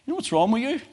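hammed-up rising challenge; imitated pastor's challenge. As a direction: mimic the other pastor calling him out. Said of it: "he said".

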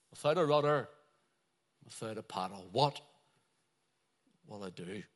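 low, hushed, mildly forlorn voice; lost and helpless. Acted. Voicing Isaiah adrift and lost.

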